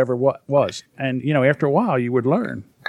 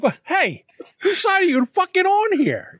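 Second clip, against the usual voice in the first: high, laughing, indignant protest; indignant high yelp. Retold or imitated. Dad protesting to his ex-wife, much higher laughing indignation. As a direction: ham up the betrayed dad. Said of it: "And I'm like".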